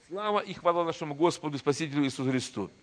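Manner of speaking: ordinary talk, nothing marked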